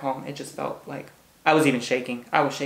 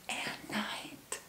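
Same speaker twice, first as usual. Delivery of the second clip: whisper; 19 dB quieter than usual. Real, not acted. Trailing dreamy aside.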